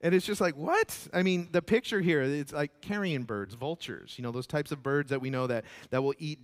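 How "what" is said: mock surprise.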